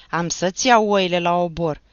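loud threatening growl; threat. Acted. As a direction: threaten to seize the sheep. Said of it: "amenință pândarul". (amenințare tare)

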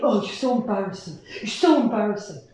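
put-on low, soft teen-daughter groan; mock the girls' eye-rolling complaint; a light impression of her girls. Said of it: "The girls keep saying".

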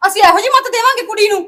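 high, loud indignant outburst; outraged shout. Acted. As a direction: shout it back in disbelief. Outraged sister in family quarrel. (ਗੁੱਸੇ ਵਿੱਚ ਉੱਚੀ ਚੀਕ)

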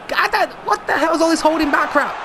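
high, loud frustrated yell; real annoyed shouting; angry shout. Real, not acted. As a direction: rant at the team. Angry at passive players.

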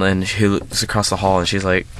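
ordinary talk, nothing marked

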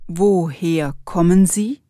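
normal talking voice

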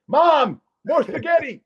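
very high, loud call to mom; needy grown son. Acted. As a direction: holler for mom like an overgrown kid in an ad. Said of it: "these guys in the commercial".

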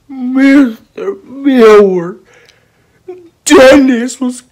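high, loud, drawn-out pupil voice; whiny pupil. Acted. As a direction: whine slowly for the teacher's attention. Pupil telling on classmate.

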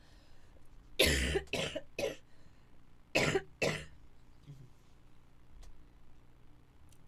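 repeated bursts of hard coughing; real, long coughing spell; real coughing fit. Real, not acted. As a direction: cough in bouts with gaps between. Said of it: "It's going again".

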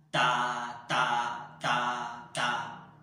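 every "ta" hit very loud and forceful; four aggressive, energetic blasts of "ta"; marcato. Shown as a demonstration. Teacher demonstrating four marcatos, forceful loud "ta". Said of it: "Sonaría de esta manera".

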